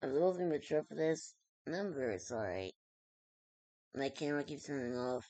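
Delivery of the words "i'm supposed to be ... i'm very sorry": ordinary delivery, nothing marked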